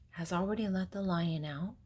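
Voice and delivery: usual speaking voice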